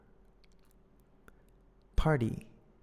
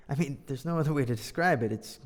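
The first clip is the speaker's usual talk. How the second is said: ordinary delivery, nothing marked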